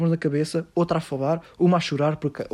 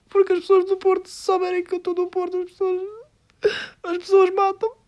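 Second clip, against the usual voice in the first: mock crying building up. Mocking the crying juror's fame speech, sobbing high and rising. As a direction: overplay the tearful star until her voice cracks into sobs. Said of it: "ela soluçava a chorar".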